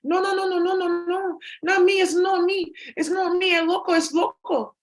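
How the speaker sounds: frantic denial voice. Acted. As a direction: squeal the denial in a put-on voice. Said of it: "after talks of".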